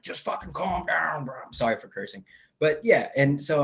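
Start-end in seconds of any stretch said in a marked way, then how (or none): 0.0-1.4 slower, higher voice for dad telling him off; lightly voiced exasperated father, drawn out; imitating dad. Dad on the phone telling his drunk son to calm down, slow and higher. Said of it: "my dad's like".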